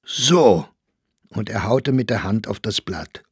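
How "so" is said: short, loud outburst; angry cry. A narrator's reading. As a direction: throw it out loudly in anger. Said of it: "schrie Staufner". (lauter, empörter Ausruf)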